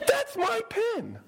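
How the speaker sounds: high, slow, outraged cry; indignant cry. Retold or imitated. Missionary spotting his pen in a tribesman's nose, indignant cry. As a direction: cry out over a stolen pen. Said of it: "He's like".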